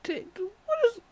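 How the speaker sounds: high, squeaky panicked stammer; mock-frightened yelp, breaking off; panicked disbelief. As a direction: splutter in alarm, retold. Panicked reaction to the screaming chaser.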